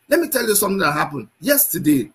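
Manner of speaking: normal talking voice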